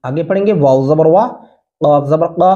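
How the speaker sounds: normal talking voice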